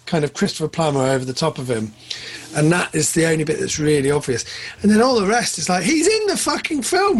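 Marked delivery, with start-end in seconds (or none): 5.8-7.2 high, incredulous outburst; astonished, pitched well above usual; amazed exclamation. Real, not acted. Amazed at reshot film.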